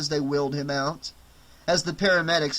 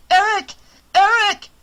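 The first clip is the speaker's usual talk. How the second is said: much higher, much louder cry of his name; yelling; 10 dB louder than usual. Acted. Girl bursts in and yells for Eric, loud and high. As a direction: shout for him across the room. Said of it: "a female voice yelled".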